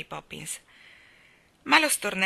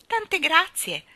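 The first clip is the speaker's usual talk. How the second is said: high, gentle little voice; polite baby Annabella; 11 semitones higher, 7 dB louder, much slower than usual. A narrator's reading. Newborn thanks the sunlight, high polite voice. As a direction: give sweet thanks in a high voice. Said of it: "disse Annabella gentilmente".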